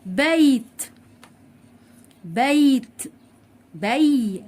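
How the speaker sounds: بيت with the y sounded distinctly, "beyyt"; very clear y. Shown as a demonstration. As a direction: linger on the y glide in بي before the t. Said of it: "نطقت الياء بشكل واضح قوي".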